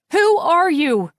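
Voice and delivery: high, loud frightened scream. A narrator's reading. Natalia screaming at the stranger on the line, loud and high. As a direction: shout it down the phone in terror. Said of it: "I scream".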